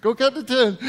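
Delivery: high, gleeful mock-children's voice; imitating children, pitched well up; kids catching dad out. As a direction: crow it like a kid who caught a parent. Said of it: "They'd be like".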